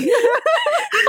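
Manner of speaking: high squealing laugh breaking off; cracked excited laugh. Real, not acted. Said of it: "太激动了".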